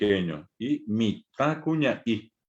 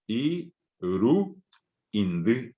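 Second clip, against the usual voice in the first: Irundi split as i, ru, indi with pauses; teacher's paused syllable demo; syllables apart; much slower than usual. Shown as a demonstration. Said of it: "es la sílaba".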